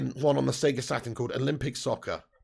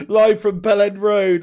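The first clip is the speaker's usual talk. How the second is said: slow, loud and higher, hammed-up live intro; mock broadcaster; 5 semitones higher, 10 dB louder, slower than usual. Streamer joking about a viewer's name, mock broadcaster. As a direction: ham it up like a TV anchor.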